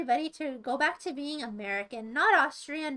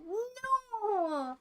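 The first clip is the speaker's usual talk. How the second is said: mock protest; 6 semitones higher, 4 dB quieter than usual.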